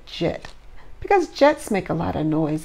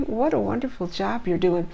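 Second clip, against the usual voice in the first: ordinary delivery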